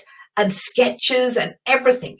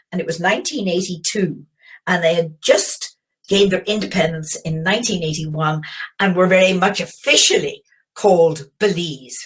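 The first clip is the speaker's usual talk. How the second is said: ordinary talk, nothing marked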